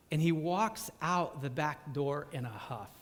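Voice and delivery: ordinary talk, nothing marked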